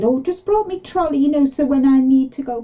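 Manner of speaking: put-on chirpy old mum brushing it off; mimicking mum. Daughter mimics her mum's breezy excuse. Mum voiced in a retold story.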